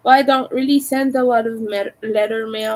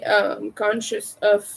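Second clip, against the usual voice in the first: ordinary delivery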